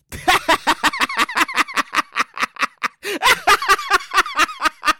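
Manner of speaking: long high rattling cackle; laughing. Real, not acted. Host laughs at his own collections joke, high cackle. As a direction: crack up in a drawn-out, rapid laugh.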